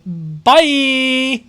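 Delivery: high, loud mocking bye; mocking echo. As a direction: mimic her sign-off. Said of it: "end it with".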